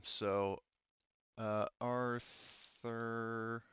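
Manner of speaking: usual speaking voice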